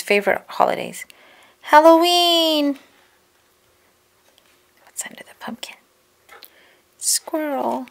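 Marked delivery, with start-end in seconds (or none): 1.6-2.8 high, drawn-out, gleeful announcement; excited reveal. A narrator's reading. Reader revealing Corduroy's favorite holiday to children, excited. 4.8-5.8 whispered, secretive question; hushed curiosity. A narrator's reading. Reader lifting a flap with children, whispering. 7.0-7.9 higher, lilting little reveal; soft delighted discovery. A narrator's reading. Reader revealing what's under the flap, pleased surprise.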